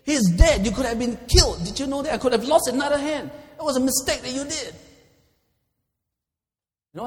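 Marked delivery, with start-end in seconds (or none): 0.0-4.7 loud, high-pitched scolding; angry shout. Acted. Captain rebukes soldier over the failed rescue, angry shouting. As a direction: shout it as a furious officer's reprimand. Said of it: "The captain got angry and shouted".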